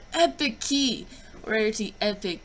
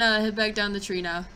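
ordinary talk

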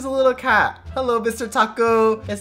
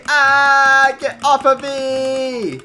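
loud high cry for help; panicked struggle; 6 semitones higher, 7 dB louder, much slower than usual. Acted. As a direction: yell as if being grabbed. Struggling against someone grabbing him.

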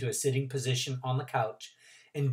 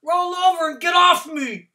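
muffled shout; 10 dB louder than usual. Acted.

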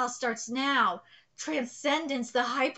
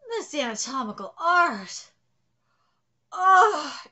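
ordinary talk, nothing marked

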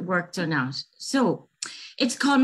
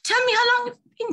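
startled leprechaun; 3 dB louder, faster than usual. Acted.

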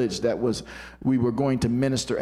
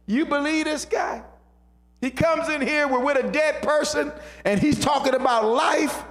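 high-pitched mock disbelief; sarcastic scoffing; 14 semitones higher than usual. Doubters scoffing at Jesus among themselves, sarcastic disbelief. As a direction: scoff as one of the doubting crowd. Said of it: "Doubt always says that about faith".

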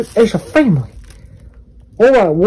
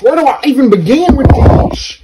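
ordinary talk, nothing marked

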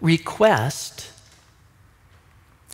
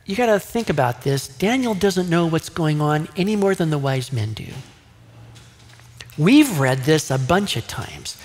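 ordinary talk

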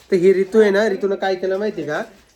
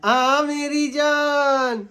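tender coo; 6 semitones higher, much slower than usual. Real, not acted. Cooing to baby.

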